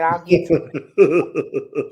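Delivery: slow, laughing brush-off; drawn-out laughing promise to the granddaughter; laughing dodge. Retold or imitated. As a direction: stall her with a slow chuckle. Dodging granddaughter's question about book.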